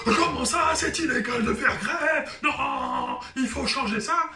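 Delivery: high, loud outraged striker with a laugh in it; hammed-up indignant worker shouting at the bosses; mock striker protest. A striker yelling indignantly at the capitalists, mock outrage.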